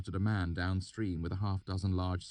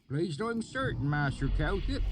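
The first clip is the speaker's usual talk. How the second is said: high rustic country voice; oily backwoods dialect; thick valley accent; 12 semitones higher, 4 dB louder than usual. A narrator's reading. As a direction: swineherd musing in a thick rural accent. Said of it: "his accent so thick".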